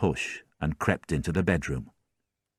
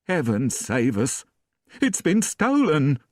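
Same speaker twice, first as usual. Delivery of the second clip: rising to a high, loud panicked cry; 8 semitones higher, 3 dB louder than usual. A narrator's reading. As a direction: wail that the silver is gone. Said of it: "she cried".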